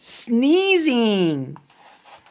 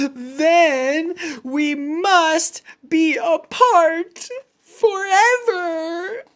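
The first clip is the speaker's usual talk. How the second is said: slow, rising higher into a crying wail; tearful despair; 7 semitones higher, slower than usual. Acted. Gerald to Piggie, crying over parting forever, tearful wail. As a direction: wail it like a heartbroken elephant.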